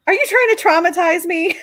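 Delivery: playing up fake outrage in the voice; mock shock and disgust. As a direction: act scandalised at her mother. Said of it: "trying to get the level of shock and disgust in my voice".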